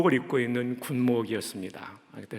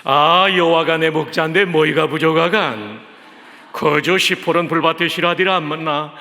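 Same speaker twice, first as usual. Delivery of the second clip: louder, punchy Pyongan dialect; 3 semitones higher, 7 dB louder than usual. Shown as a demonstration. Pastor entertaining the congregation with a louder Pyongan-dialect Psalm 23. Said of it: "평안도 버전입니다".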